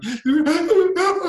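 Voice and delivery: high, loud imitated kid's wail; exaggerated child's bawling, held high; mock crying wail. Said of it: "the crying".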